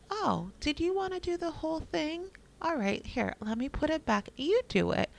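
sugar-coat it like a parent biting back frustration; mock-patient mom offering the toddler a redo; forced calm. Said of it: "me trying to stay calm".

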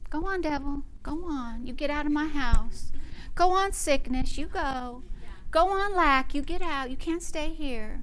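soft, half-hearted shooing; feeble, quiet mock command; mock timid comforting. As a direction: meekly coax it to leave, no force. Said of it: "all the comfort you like to give him".

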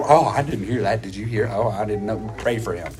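the other gossip. Retold or imitated. Sister Clara answers the gossip, low mock-pious murmur. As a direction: murmur it low with fake concern. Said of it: "And then the other".

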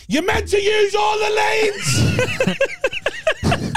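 retold shout. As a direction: yell it at the driver who beeped. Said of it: "rolled down my window and shouted".

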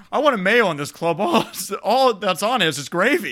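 hammed-up fussy complainer; mock-outraged customer. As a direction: whine in comic indignation about lunch. Voicing outraged mayo-loving coworker.

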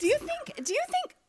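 high sing-song question; high teasing. Pretend. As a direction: tease in a high voice.

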